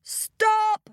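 high, exasperated shout; high-pitched royal yell; king's shout. A narrator's reading. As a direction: cry out to halt the uproar. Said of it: "shouted the king".

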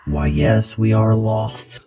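measured, a hint of a robot. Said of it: "I did my, my robot voice".

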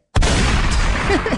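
breathless high cackle at his own joke; high wheezing laugh. Real, not acted. Laughing at his own Kardashian joke, high wheezing laugh. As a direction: wheeze out a laugh, almost silent.

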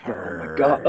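lightly voiced dog in pain; dog's voice. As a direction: voice the dog as a deep moaning beast. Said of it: "He's like".

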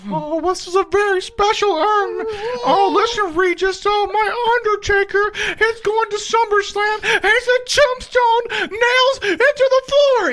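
very high, slow, drawn-out manager voice; high, slow creepy voice. Retold or imitated. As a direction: stretch it out high and eerie. Mocking Paul Bearer's high voice.

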